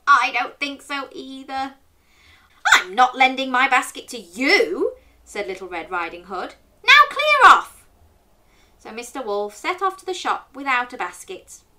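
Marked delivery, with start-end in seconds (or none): 2.7-5.0 loud, mock-cross storybook refusal; emphatic, swooping rebuff; indignant refusal. A narrator's reading. 6.8-7.9 high, shouted storybook send-off; loud, slow, shrieked brush-off; angry dismissal. A narrator's reading.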